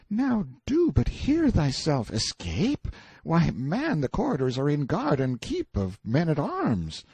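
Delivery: put-on high boy's voice, protesting; incredulous boy Clarence. A narrator's reading. Boy tells prisoner escape is hopeless, incredulous. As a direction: scoff at the very idea, pitched high.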